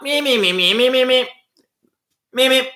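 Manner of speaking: very high rapid cartoon meeps; Roadrunner impression. Teasing Belfast accent as Roadrunner, high meeps. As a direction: squeak it out like the cartoon bird. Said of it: "They sound like the Roadrunner".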